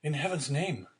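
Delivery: high-pitched, pleading exclamation; distressed cry. A narrator's reading. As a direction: cry out in unhappy appeal. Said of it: "cried the unhappy young man".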